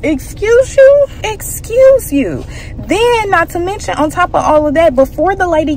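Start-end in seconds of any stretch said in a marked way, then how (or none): 0.0-1.1 high, slow, loud rising question; drawn-out, sassy comeback with the question rising; sassy excuse you. Retold or imitated. Snapping back at the salon owner, slow, high and sassy. 1.2-2.4 high, slow excuse you, falling shut like a verdict; slow, high, and settled; final excuse you. Retold or imitated. Repeating it to the salon owner as a closing put-down, high and slow.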